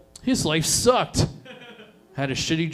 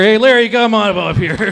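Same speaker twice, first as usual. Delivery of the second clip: loud, higher-pitched summons; calling out; 6 semitones higher, 11 dB louder than usual. Acted. Comic calling a man up from the crowd, calling out loudly. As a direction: shout someone up to the stage.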